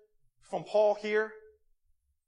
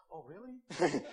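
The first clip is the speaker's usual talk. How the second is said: fake interested; 4 semitones lower, 7 dB quieter, much slower than usual. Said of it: "That kind of face".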